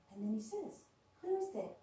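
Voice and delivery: ordinary talk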